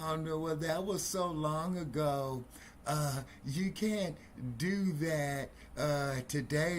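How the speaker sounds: high, slow and dismissive; mock dismissive official. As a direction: drag it out like brushing off the past. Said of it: "He'll say".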